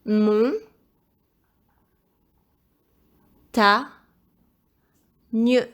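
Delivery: loud, isolated syllables with long pauses; syllable-by-syllable dictation. Shown as a demonstration. Teacher helping pupil spell montagne, syllable-by-syllable dictation. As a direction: break "Mon. Ta. Nieu." into separate beats.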